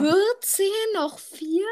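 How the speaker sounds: high, startled disbelief; can't believe it's that many; surprised. Real, not acted. Shocked at how many remain.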